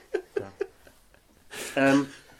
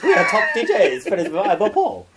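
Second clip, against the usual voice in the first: higher, louder DJ boast; 6 semitones higher, 5 dB louder than usual. Pretend. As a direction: brag like a cheesy DJ. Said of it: "my D J voice".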